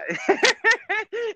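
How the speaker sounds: a burst of squeaky high laughter. Real, not acted. Remembering the mugshot link, high squeaky laugh.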